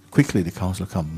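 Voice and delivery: ordinary delivery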